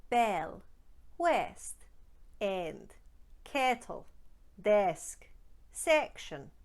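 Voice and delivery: "Bell", "west", "end" with the eh vowel held long; Scottish long eh. Shown as a demonstration. Coach drills the long Scottish eh. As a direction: hold the eh in "kettle", "desk", "section". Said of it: "Shall we try a few more?".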